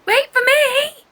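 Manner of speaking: very high loud cry; shout. A narrator's reading. Angel shouting to Chocolate as she runs, loud high cry. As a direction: run after her yelling. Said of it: "Angel shouts to Chocolate".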